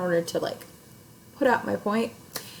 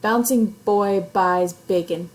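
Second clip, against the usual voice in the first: put-on slow and loud, every B of "bouncing boy buys bacon" popped; stressed B sounds; 5 dB louder, slower than usual. Shown as a demonstration. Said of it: "highlight the poem's sound devices".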